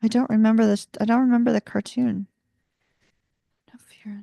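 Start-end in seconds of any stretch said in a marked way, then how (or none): 3.7-4.1 whisper. Real, not acted. Whispered aside.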